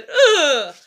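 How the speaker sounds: loud, disgusted "ugh"; real fed-up groan; exasperated groan. Real, not acted. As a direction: groan loudly in frustration. Exasperated mid-rant.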